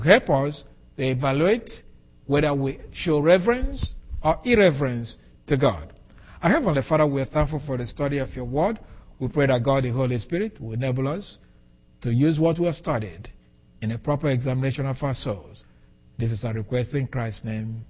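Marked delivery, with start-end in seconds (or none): 6.4-17.8 softer, lower praying voice, evenly paced; closing prayer. Real, not acted. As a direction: bring it down into a quiet, reverent prayer. Closing prayer after the teaching.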